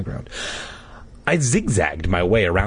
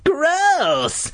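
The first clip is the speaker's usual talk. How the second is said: high sister voice. Acted. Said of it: "Terry replied".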